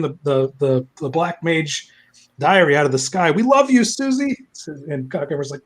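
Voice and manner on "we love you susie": high crowd call; mock fan adoration. Pay pigs calling to their idol, mock adoring. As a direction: cheer for your idol in a put-on voice.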